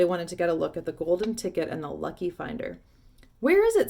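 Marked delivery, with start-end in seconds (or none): 3.4-3.9 high, loud shout from the crowd. A narrator's reading. Stranger in the crowd, shouting to see. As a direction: call out over the crowd. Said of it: "somebody shouted".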